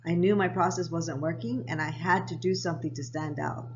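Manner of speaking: ordinary talk, nothing marked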